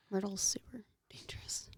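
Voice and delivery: hushed, secretive conspiracy whisper; conspiracy theory voice. Pretend. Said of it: "[name] is using her conspiracy theory voice".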